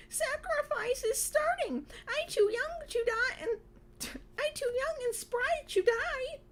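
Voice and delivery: very high, squealing mock-terrified voice; panicked wail. A narrator's reading. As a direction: wail theatrically, close to tears. Panicked character's wail in story.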